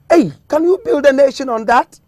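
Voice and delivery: loud, high-pitched rhetorical challenge; challenging. Real, not acted. Guest challenges believers who wait on prophecy, loud and challenging.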